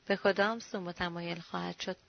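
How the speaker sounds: usual speaking voice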